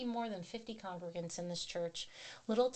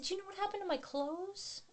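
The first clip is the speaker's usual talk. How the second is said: pitched way up, puzzled and innocent; high innocent question; 11 semitones higher, 3 dB quieter than usual. Retold or imitated. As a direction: ask sweetly, way up high, clueless. Asking husband about missing clothes.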